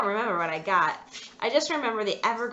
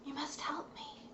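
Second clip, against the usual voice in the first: soft unvoiced whisper; a doll's eerie unvoiced plea; 6 semitones higher, 13 dB quieter than usual. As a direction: breathe it like a secret. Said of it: "I heard her whisper to me".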